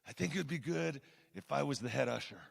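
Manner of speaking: lightly voiced mobster, soft and low; a hint of the Godfather, quietly; Glenn. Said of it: "he talks like this".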